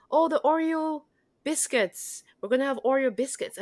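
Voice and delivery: high, bright-eyed announcement of the Oreo biscuits; confident announcing. Retold or imitated. Orientation guide confidently telling students about snacks. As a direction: say it like you're sure everyone knows the word. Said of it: "very confidently of whatever I would say".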